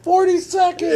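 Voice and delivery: high-pitched awe with a laugh in it; hammed-up wide-eyed wonder, amused; mock fascination. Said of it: "looking at the microwave all fascinated".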